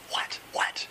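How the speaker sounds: hushed, puzzled "what"; acted whispered confusion; retold baffled aside. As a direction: break off and ask under the breath. Himself answering his wife.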